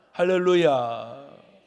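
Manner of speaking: loud, long-drawn hallelujah; booming praise call. Real, not acted. Preacher calling congregation to praise, loud drawn-out cry. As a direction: belt it out slowly.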